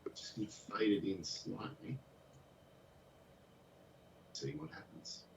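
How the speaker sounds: hushed whisper while aiming. Real, not acted. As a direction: whisper to yourself over the shot. Lining up a shot quietly.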